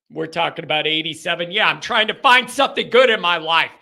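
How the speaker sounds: normal talking voice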